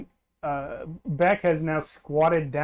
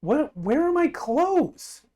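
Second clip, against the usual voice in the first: high, drawn-out alarmed question; 9 semitones higher, slower than usual. Acted. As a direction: wail it, suddenly realizing you're naked. Beck discovers she is naked.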